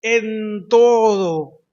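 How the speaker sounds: loud, elongated "en todo" from the pulpit; stress on en todo. Acted. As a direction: drag out "en todo" as the climax. Stresses key verse words.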